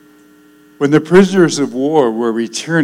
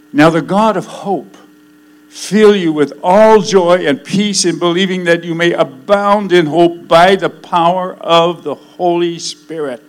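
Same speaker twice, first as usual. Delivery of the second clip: raised, ringing Bible reading; scripture reading; 4 semitones higher, 5 dB louder than usual. Acted. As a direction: proclaim the verse from the pulpit. Reads scripture aloud.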